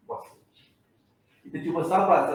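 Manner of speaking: ordinary talk, nothing marked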